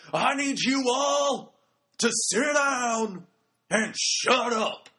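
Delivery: loud, high, drawn-out Southern bellow; bad Southern-ish impression, booming and slow; Devon. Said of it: "my really bad impression of Devon".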